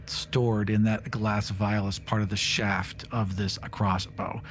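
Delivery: usual speaking voice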